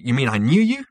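high, loud indignant question; angry. A narrator's reading. Zaphod challenges Zarniwoop over knowing him, indignant anger. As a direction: demand it in angry disbelief. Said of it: "said Zaphod angrily".

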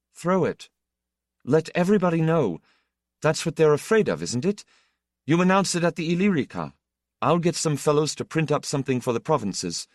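high, fast, rushing with conviction; eager and urgent. Acted. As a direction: fire off the plan, breathless with daring. Itale urges publishing the news.

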